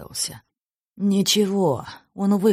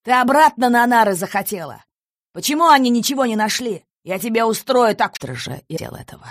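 higher, louder threatening yell; angry shout; 6 semitones higher, 4 dB louder than usual. A narrator's reading. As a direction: bark threats down the phone. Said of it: "закричал".

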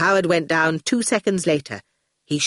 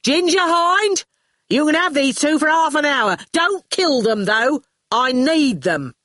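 high, bossy calling voice; shouted orders; 8 semitones higher, 3 dB louder than usual. Acted. Shine hands the children to Hind, shouting. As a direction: hand out orders to a henchman at a shout. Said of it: "she shouted".

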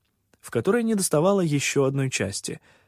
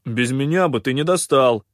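loud, booming big voice; booming bass voice; 6 dB louder than usual. Acted. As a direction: boom it out like a big fellow. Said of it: "басовито прогудел Кианг".

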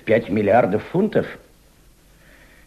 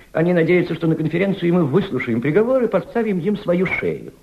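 indignant rise; 9 semitones higher than usual. Acted. Elder warns Volodya of the enemies, indignant. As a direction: warn hard about the enemies.

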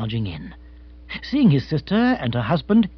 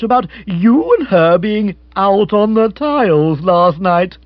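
louder, jovially prying gentleman; hearty brother-in-law; 6 dB louder than usual. Acted. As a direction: boom out a teasing question. Rory hails and questions Jeeves.

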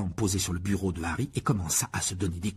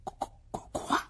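stunned, breathless stammer; a stunned, whispered stammer; 5 dB quieter, slower than usual. Acted. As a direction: stumble over the word in shock. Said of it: "balbutia Harry".

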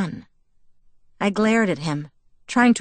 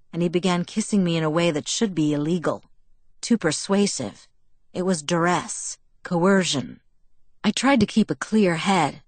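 ordinary delivery, nothing marked

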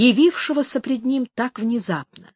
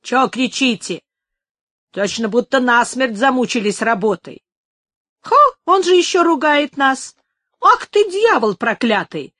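rising, louder, heated cursing; angry exclaiming; 4 semitones higher, 4 dB louder than usual. A narrator's reading. Workers cursing Benito before the fistfight, angrily. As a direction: work up into a furious brawl. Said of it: "воскликнули работники".

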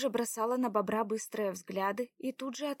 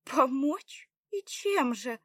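higher, louder scoffing question; bitterly mocking; 5 semitones higher, 6 dB louder, much slower than usual. A narrator's reading. Заряна doubts his offer of help, bitter scorn. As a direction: scoff at the offer with a rising first word. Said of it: "усмехнулась горько Заряна".

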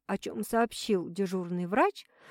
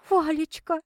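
high pleading whine; whimpering plea; 7 semitones higher than usual. A narrator's reading. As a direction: whine his name, begging. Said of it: "умоляюще прохныкала пациентка".

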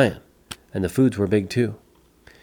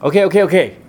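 high, loud, hurried agreement; anxious, placating outburst; worried giving-in; 7 semitones higher, 5 dB louder, faster than usual. Acted. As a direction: give in nervously under threat. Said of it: "Pedro was worried".

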